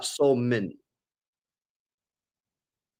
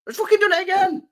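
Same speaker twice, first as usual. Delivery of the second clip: pitched way up, frustrated; exasperated high cry; 3 dB louder than usual. Real, not acted. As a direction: squeal it in annoyance. Exasperated at beeping.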